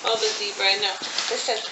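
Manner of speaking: usual speaking voice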